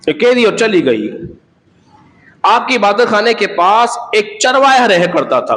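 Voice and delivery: normal talking voice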